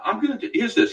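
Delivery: ordinary talk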